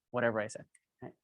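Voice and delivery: ordinary delivery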